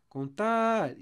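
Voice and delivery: high, loud call, "tar" stretched. Shown as a demonstration. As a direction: call the word "Contar" to find its strong syllable. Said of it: "eu tô chamando a palavra".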